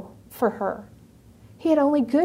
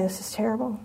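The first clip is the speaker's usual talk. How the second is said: dismayed murmur; 2 semitones lower, 8 dB quieter, faster than usual. Retold or imitated. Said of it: "I was just sitting there going".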